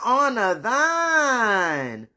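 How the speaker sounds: loud, high, long-drawn holler; mock proclamation. Quoting the good book about her father, hammed-up loud proclamation. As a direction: stretch THINE out into a big loud wail. Drawn-out comic repeat of scripture.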